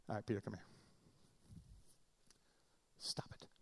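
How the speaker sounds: low, hushed mock aside; hushed private rebuke. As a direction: take someone aside and scold under your breath. Acted discreet private rebuke, not public.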